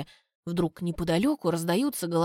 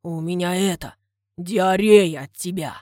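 a clumsy suitor confessing love, loud and earnest; clumsy confession; 3 semitones lower, 5 dB louder than usual. Acted. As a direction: blurt it proudly. Suitor blurts compliment.

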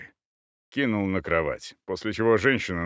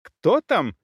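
higher, more urgent woman's call; repeated cry; 8 semitones higher, 4 dB louder than usual. A narrator's reading. Woman calls at intruders a second time, alarmed cry. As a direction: call it out sharply through the door. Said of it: "крик повторился".

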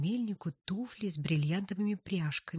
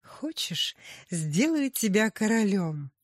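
tempting offer; 4 semitones higher, 5 dB louder than usual. Acted. Ursula tempts the miller.

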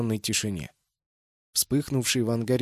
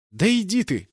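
annoyed outburst; 8 semitones higher, 5 dB louder than usual. A narrator's reading. Отмахивается от шутки.

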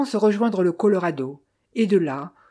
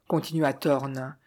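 plain reading voice

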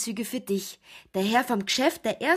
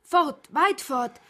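higher and louder, pressing him; urgent plea; 6 semitones higher, 4 dB louder than usual. Acted. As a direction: urge him to flee at once. Sie drängt ihn zur Flucht. (drängend, höher und lauter)

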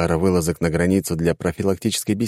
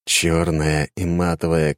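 usual speaking voice

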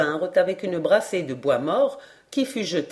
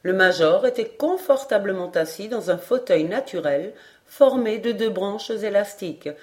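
plain reading voice

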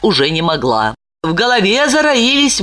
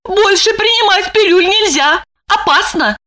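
frightened woman; 12 semitones higher than usual. A narrator's reading. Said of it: "спросила она, в ужасе".

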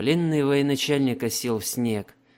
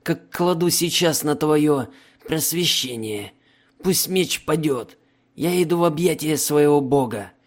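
defiant prisoner voice; 4 semitones higher, 5 dB louder than usual. Acted.

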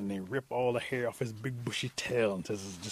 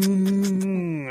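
loud, high, long "mmm"; drawn-out smooch noise; puckered kissing hum; 8 semitones higher, 10 dB louder than usual. A narrator's reading. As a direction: hum through puckered lips, going in for a kiss. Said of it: "coming at him going".

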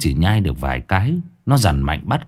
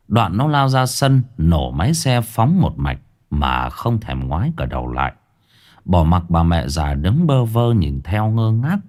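usual speaking voice

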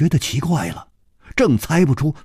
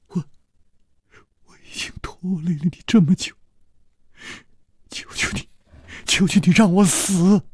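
hushed, broken pleading in tears; tearful plea. A narrator's reading. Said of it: "泪流满面".

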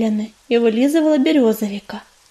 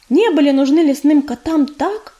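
ordinary delivery, nothing marked

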